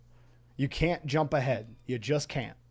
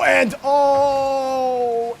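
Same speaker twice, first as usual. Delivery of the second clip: surprised shout; 10 semitones higher, 8 dB louder than usual. Real, not acted. Live commentary, shot just missed.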